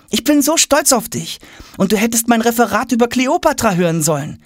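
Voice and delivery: put-on high-pitched boy's voice; proud, eager boy. A narrator's reading. Tom praises father and boasts of his report, eager. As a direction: gush to dad in a high boyish register.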